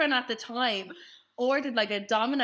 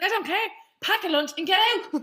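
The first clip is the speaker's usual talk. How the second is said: higher, louder mock mum order; mock stern order; 7 semitones higher, 4 dB louder than usual. Mum ordering the idle students out, mock stern. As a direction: boss them out the door like an exasperated mum.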